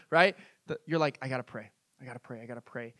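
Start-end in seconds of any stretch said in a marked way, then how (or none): 1.2-2.9 low, very soft repeated plea; muted self-urging. Acted. Starving faster urging himself to pray, hushed and low. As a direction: sink into a private chant to yourself.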